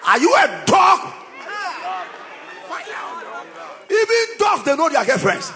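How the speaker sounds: fiery preaching. Acted. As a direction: thunder at the sinners. Preacher shouts against sin.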